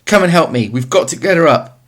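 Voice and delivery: high, loud desperate plea for help; panicked call. Acted. Tesfaye calling Mamo to lift the drowning cow, panicked shouting.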